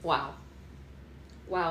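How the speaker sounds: usual speaking voice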